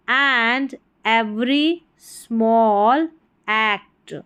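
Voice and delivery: ordinary talk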